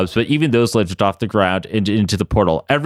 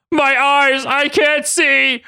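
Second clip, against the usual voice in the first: screaming; 14 semitones higher, much slower than usual. Acted. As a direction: wail as if blinded. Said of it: "Apocalypse screamed".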